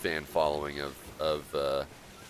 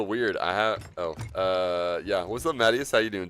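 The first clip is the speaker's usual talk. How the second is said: ordinary delivery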